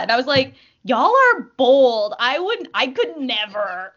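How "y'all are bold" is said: incredulous. Retold or imitated. Reacting to bold Instagram messages.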